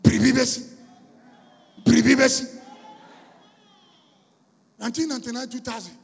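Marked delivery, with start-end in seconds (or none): 0.0-2.6 loud ringing preacher call; preaching call. Acted. Preacher opens the sermon with a loud call. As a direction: call it out to the congregation.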